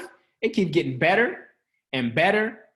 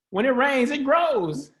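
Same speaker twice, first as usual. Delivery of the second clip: upbeat higher take; 7 semitones higher, slower than usual. Shown as a demonstration. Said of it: "The change on that".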